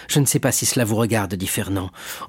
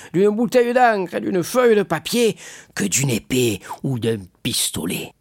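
put-on drunken musing; 10 semitones higher than usual. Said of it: "en laissant tomber sa main sur le papier".

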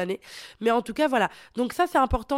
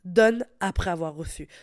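usual speaking voice

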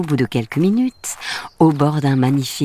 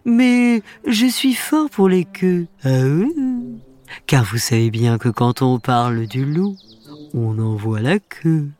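friendly wolf voice. A narrator's reading. Wolf speaks.

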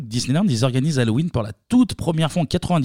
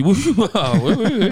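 eager yes through laughter; laughing yes; 6 semitones higher, 3 dB louder than usual. Retold or imitated. Acts eager sellers saying yes.

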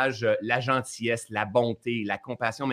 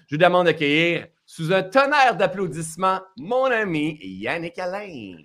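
raised announcer call, drawing out the name; guest introduction; 5 semitones higher, 3 dB louder than usual. Real, not acted. Host asks audience to applaud his friend, announcer-style build.

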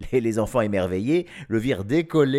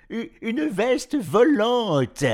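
stammered start into a slow, high, loud cry; excited shout; 12 semitones higher, 7 dB louder, much slower than usual. A narrator's reading. Professor announcing the flying jacket, shouting excitedly. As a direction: call out with a laughing thrill, stretched out. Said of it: "lui cria le professeur".

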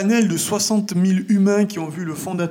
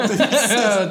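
laughing; 4 semitones higher, 4 dB louder than usual. Real, not acted.

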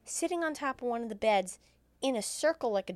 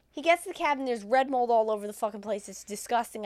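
ordinary talk

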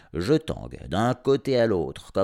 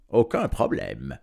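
voix plus grave et lente, sûre de soi; vantard; 6 semitones lower, 3 dB louder than usual. A narrator's reading. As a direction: se vanter tranquillement. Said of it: "se vante notre visiteur". (le vétérinaire à la dame, voix grave vantarde)

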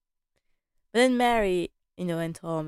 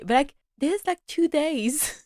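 incredulous; 8 semitones higher than usual. Real, not acted. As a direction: stretch it out like it's absurd. Incredulous, higher, slow.

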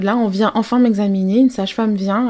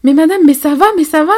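higher, louder flustered questions; panicked midwife; 6 semitones higher, 6 dB louder than usual. Retold or imitated. Midwife bursting in to the patient, lightly panicked voice. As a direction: play the midwife rushing in, alarmed. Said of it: "complètement paniquée".

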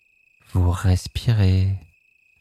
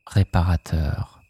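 ordinary talk

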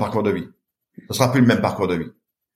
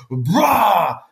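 crash noise; 7 semitones higher than usual. Retold or imitated. Wave crashing onto him.

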